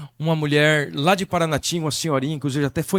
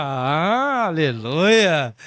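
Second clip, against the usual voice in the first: long, swooping cry of praise; exultant hallelujah; much slower than usual. Retold or imitated. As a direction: stretch the hallelujah out in a wavering swoop. Retells his rejoicing at confirmation. (aleluia exultante, bem arrastado)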